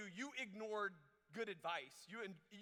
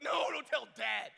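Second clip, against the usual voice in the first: loud, drawn-out shouted plea, higher pitch; mock panic; 4 semitones higher, 11 dB louder, slower than usual. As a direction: blurt a hammed-up, desperate protest. Retold panicked plea to mom.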